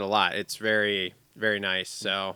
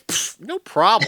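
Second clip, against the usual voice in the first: dismissive pfft; 9 semitones higher, 4 dB louder than usual. Acted. Dismissive bravado about tornado.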